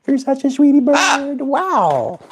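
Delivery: very high squeaky coo. Real, not acted. Same line in squeaky voice.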